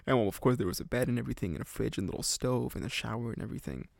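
ordinary delivery

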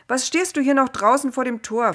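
high, forceful question to a newcomer; hearty hail. A narrator's reading. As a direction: call out to a guest at the gate. Relative hails guest at the gate.

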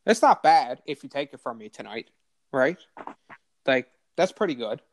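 ordinary talk

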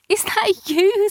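very high, drawn-out question; astonished recognition. A narrator's reading. Sophia recognising the Galley Girls, astonished. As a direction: squeal it in happy disbelief.

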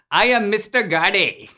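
mock Indian accent, high and loud; a polite immigrant pitching himself, hammed-up high voice; Mister Gadde. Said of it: "imaginative rendition".

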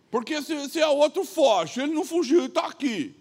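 put-on high, loud drunken proclamation; drunk man. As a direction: imitate a drunk marvelling out loud. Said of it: "Aí chegou o bêbado". (imitação de bêbado exaltado, voz aguda)

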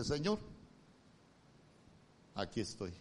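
softly, near a hush; a hushed, humble prayer to the Lord; quiet, not shouting. Pretend. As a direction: speak it gently, no need to shout. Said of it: "no tener necesidad de gritar".

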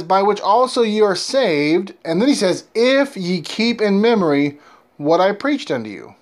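preaching. Acted. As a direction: preach it slowly with weight. Emphatic preaching, slow.

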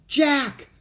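high, loud call of alarm; cried out. A narrator's reading. Annie finds her brother Jack in trouble, crying out. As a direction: shout the name with a sharp fall. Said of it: "cried Annie".

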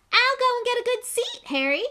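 put-on very high, loud piping boy. A narrator's reading. Colin calls after Harry in a very high piping voice. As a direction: pipe it out as you run off. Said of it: "in a piping voice".

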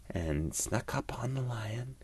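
soft sneaky whisper. A narrator's reading. Sneaking narrated.

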